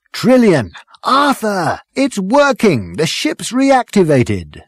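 high thrilled calling out; excited shouting. A narrator's reading. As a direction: shout the great news. Ford bursts in excited.

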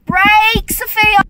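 panicked yelling. Acted. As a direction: yell at the driver in alarm. Passenger yelling at driver.

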